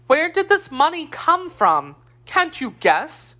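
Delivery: high, sharp demanding question; indignant. A narrator's reading. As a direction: press him hard, pitch up. Wife demanding answers.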